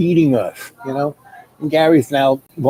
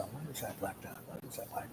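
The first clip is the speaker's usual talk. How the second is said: whisper; 2 semitones lower, 27 dB quieter than usual. Retold or imitated. Spotting a strange dot, hushed.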